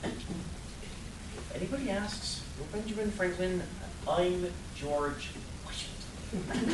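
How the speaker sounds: slow hushed whisper, plotting; conspiratorial whisper. Acted. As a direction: lean in and breathe it like a secret plan. Conspiring to sneak past guard.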